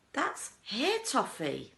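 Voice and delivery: high, slow proud reveal; proud announcement. A narrator's reading. Wonka proudly showing hair toffee, slow and high. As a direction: present it like a showman.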